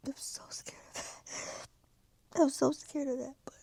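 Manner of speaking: scared whisper. Real, not acted. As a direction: whisper it in fear.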